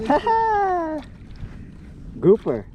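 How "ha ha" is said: triumphant laugh. Real, not acted. Fish landed, triumphant.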